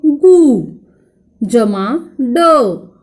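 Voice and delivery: जमा ड said very slowly, each part set apart; slow spelling out. Shown as a demonstration. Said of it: "देखो".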